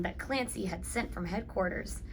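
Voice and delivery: ordinary delivery, nothing marked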